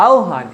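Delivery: আহ্বান pronounced ao-han; correct pronunciation demo. Shown as a demonstration. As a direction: turn the ব of আহ্বান into an o sound. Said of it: "ও উচ্চারণ হবে". (আহ্বান-এর উচ্চারণ "আওহান")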